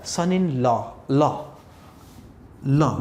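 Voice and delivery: 'लॉ' with an open 'law' vowel; correct open vowel. Shown as a demonstration. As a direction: model 'सन in लॉ', last word open, not 'lo'. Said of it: "इसका जो सही प्रचारण".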